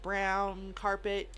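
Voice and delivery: ordinary talk, nothing marked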